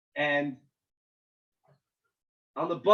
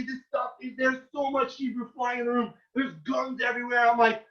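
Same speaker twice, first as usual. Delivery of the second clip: usual speaking voice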